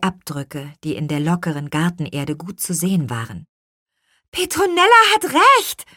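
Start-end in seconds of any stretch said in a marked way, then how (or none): 4.3-5.9 put-on very high, loud girl's cry; high-pitched child calling out. A narrator's reading. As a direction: exclaim it excitedly, pitched way up. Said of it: "rief Lea".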